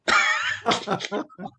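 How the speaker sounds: voiceless wheezy laugh. Real, not acted. Speaker laughs at own all-rounder joke, breathy laugh.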